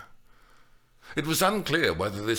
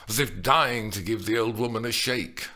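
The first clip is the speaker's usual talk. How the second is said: ordinary delivery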